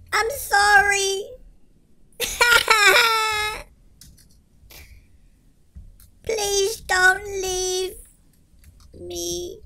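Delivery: very slow hammed-up sobbing plea; mock tearful plea. Gamer pretending to mourn Panda, slow mock sobbing plea. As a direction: drag it out like a fake goodbye.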